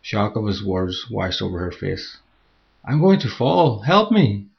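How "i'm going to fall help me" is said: high, loud panic; panicked cry. Acted. Woman losing her grip on the cliff, panicked cry. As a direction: cry out as your grip fails.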